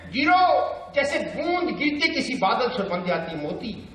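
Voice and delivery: high, declaimed line of verse; Bhavani Prasad Mishra. Acted. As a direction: lift the line up high for the audience. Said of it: "बातचीत की शैली".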